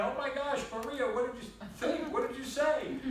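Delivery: high, loud, astonished questioning; retold amazement. Reacting to a mother's news of her son, high amazed retelling. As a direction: re-enact your stunned reaction, pitch way up.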